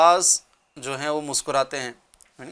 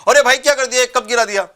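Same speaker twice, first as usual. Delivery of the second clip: loud, high, irritated snap; angry outburst; 7 semitones higher, 7 dB louder than usual. Acted. Scolding a guest who dropped the cup, angry loud snap. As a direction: blurt it out angrily over the spilled cup.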